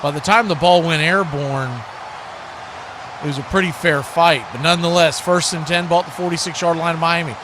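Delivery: ordinary talk, nothing marked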